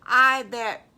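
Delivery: loud piglet protest; loud little brother objecting; loud kid. Acted. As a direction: burst in loudly. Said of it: "And Wiggly says".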